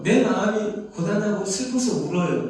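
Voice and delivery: normal talking voice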